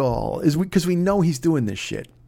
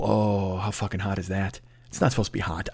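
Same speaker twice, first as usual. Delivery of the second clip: hushed and low; 8 semitones lower, 8 dB quieter than usual. Real, not acted. Fantasizing about Dolly.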